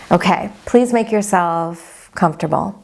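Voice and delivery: unhurried, even-toned body scan guidance; guided meditation. Acted. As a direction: guide them gently into calm, leaving space after each phrase.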